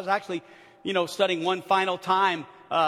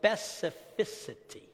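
"specificity" slow and careful, softer; 7 dB quieter, slower than usual. Shown as a demonstration. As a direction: say "specificity" slowly, syllable by syllable. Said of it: "very slow to say it right".

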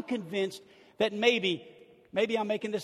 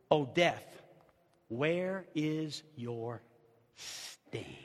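much softer, slow hushed recital, lower; 6 semitones lower, 6 dB quieter, much slower than usual. Acted. Preacher savoring the verse to his church, slow and hushed. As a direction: let the verse fall to a near hush, very slow. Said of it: "I love that little statement".

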